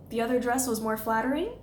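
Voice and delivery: rising uptalk ending on "flattering"; softening question inflection. Shown as a demonstration. Coach models softening a criticism, question inflection. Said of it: "soften their criticisms".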